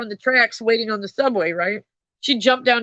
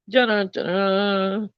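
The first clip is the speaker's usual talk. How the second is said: ominous dun-dun-dun; 3 dB quieter, slower than usual. Pretend. Streamer warns chat with a mock dramatic sting. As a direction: hum a doom sting to warn viewers. Ominous sound effect after texting.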